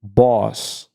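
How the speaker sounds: ordinary talk, nothing marked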